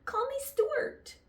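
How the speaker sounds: eager cry. Acted. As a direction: cry it out, high and drawn out. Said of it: "cried Stuart".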